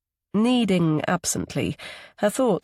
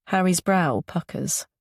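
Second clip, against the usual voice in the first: ordinary delivery, nothing marked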